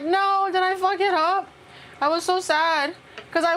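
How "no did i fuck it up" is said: panicked self-quote. Retold or imitated. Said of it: "so I was like".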